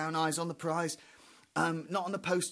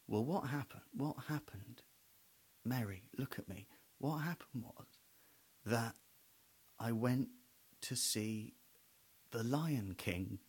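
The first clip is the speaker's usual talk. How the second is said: hushed confession; 6 semitones lower, 7 dB quieter than usual. Retold or imitated. Husband's quiet confession.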